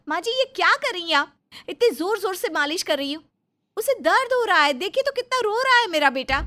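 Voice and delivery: high, loud, alarmed pleading; upset protest. Acted. Reena protests her mother-in-law's hard massage, alarmed and loud. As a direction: cry out to stop her hurting the baby.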